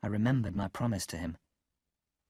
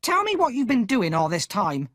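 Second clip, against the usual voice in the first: much higher, louder shrill scold; angry shout; 10 semitones higher, 5 dB louder than usual. A narrator's reading. Sister scolding Pip for coming home late, shouting. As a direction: yell at the boy furiously. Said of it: "stamping her foot".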